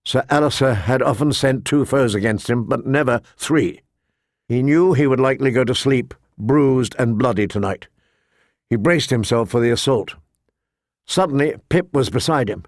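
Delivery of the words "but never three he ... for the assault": ordinary delivery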